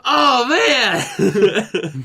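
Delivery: mock embarrassed groan; mock sheepish coworker. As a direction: play him caught out, laughing. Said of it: "And he's like".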